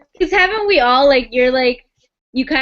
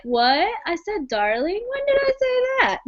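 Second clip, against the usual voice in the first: feigned innocence; 6 semitones higher, 3 dB quieter than usual. Acted. Acting out his feigned innocence.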